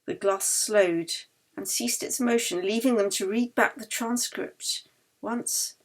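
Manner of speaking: usual speaking voice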